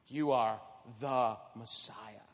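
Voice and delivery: slow, measured phrase with "the" set apart; deliberate, low-pitched weight on "the"; stress on THE. Shown as a demonstration. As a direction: hold "the" out before "Messiah". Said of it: "All these thus".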